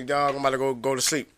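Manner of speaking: low, soft retold murmur; hushed quote. To his sleeping friend at grandma's, a soft low murmur. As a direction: keep it low so the house stays asleep.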